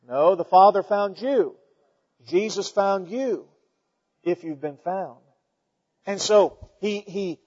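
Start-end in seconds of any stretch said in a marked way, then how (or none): none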